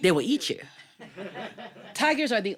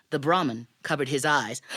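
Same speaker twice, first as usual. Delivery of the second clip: usual speaking voice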